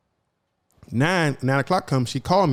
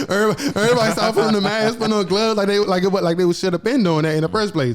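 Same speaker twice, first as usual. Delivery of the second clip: much higher voice riding on a laugh; amused, laughing; 7 semitones higher than usual. Real, not acted. Joking about hospital staff suddenly masking up, high laughing delivery. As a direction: laugh through it in high-pitched disbelief.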